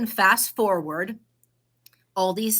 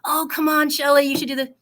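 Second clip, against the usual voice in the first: higher, louder coaxing; coaxing friend; 7 semitones higher, 5 dB louder than usual. Retold or imitated. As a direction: push her on with a bright coax. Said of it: "Oh God, I, I don't know".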